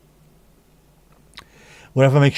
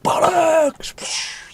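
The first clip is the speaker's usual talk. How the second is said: high cracking scream into the distance; primal scream. Shown as a demonstration. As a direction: let rip a wild primal scream.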